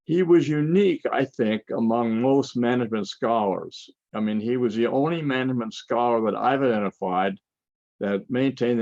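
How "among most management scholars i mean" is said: ordinary delivery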